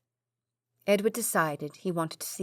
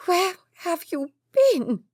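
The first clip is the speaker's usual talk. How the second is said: high, tearful mother's cry; a mother sobbing with relief; 11 semitones higher, 6 dB louder, slower than usual. A narrator's reading. Mother clutching her lost son, sobbing. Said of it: "their mother sobbed".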